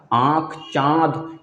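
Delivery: आँख, चाँद drawn out slowly with nasal vowels; slow nasal words. Shown as a demonstration. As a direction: stretch आँख and चाँद through the nose. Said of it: "जबरदस्ती बोलना पड़ता है".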